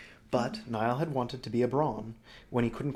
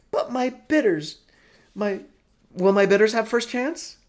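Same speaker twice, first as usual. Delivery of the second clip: Broly character voice; 11 semitones higher, 11 dB louder than usual. Acted. Broly gushes to Helva about Niall, high and loud. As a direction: gush over the man as a theatrical old schemer. Broly fretting over bidders.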